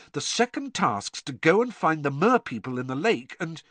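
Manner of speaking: excitement left hanging; excited. A narrator's reading. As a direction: work it out aloud, excitedly. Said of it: "said Harry excitedly".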